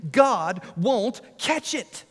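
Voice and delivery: a lightly voiced pouting toddler; small daughter.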